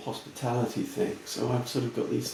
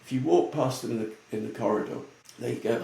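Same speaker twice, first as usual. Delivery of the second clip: ordinary delivery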